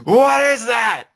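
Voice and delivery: high, loud, stretched-out shout; mock scream. Friend joining in screaming for emphasis, high mock scream. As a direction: yell it slowly at a high pitch.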